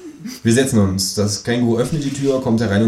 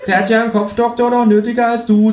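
high, louder sneering voice; mocking kangaroo; 14 semitones higher, 5 dB louder, faster than usual. A narrator's reading. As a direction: jeer at the doctor.

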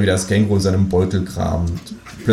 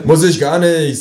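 louder, higher stubborn retort; defiant retort; 8 semitones higher, 5 dB louder than usual. Acted. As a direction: snap back at the kangaroo. Childish quarrel with kangaroo.

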